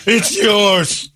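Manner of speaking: high-pitched comic panic; hammed-up mortified pleading, half laughing; mock horror. Acting horrified golfer offering his credit card.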